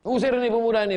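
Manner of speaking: loud, high angry yell to drive him out; a mob's furious cry; angry crowd shout. Acted. Crowd driving out the youth.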